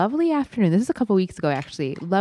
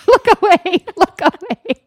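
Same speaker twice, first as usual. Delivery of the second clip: very high, loud comic wail; mock mortified cry; 12 semitones higher, 8 dB louder than usual. Parent caught in the squat run, mock mortified high cry. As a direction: beg onlookers in hammed-up embarrassment.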